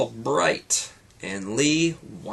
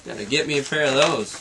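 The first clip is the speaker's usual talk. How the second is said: plain reading voice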